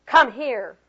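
loud, curt command; sharp order. A narrator's reading. Reading a poem about tone to teachers, sharp order. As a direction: order a child over sharply. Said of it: "I sharply ordered".

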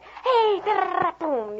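put-on high excited kid shout; kids racing. Teacher imitating kids running the room, excited shout. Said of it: "they'll find a way".

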